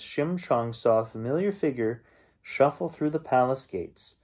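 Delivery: plain reading voice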